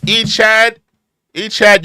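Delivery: loud call to a commenter; hey, over here. Real, not acted. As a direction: call his name out loud. Calling out to commenter.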